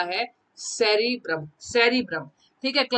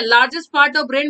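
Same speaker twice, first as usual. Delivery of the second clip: slow "largest part of brain", louder; slow emphatic dictation; 5 dB louder, much slower than usual. Shown as a demonstration. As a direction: hammer the phrase home slowly. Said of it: "ठीक है".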